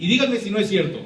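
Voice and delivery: ordinary delivery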